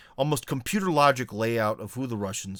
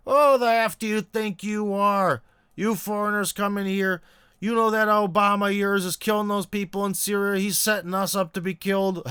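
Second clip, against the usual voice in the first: higher, hostile mock rant at a foreigner; imitating an angry neighbor; 8 semitones higher than usual. Hostile neighbor confronting the foreign journalist, higher mock rant. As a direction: play the hostile local railing at the outsider. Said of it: "he's like".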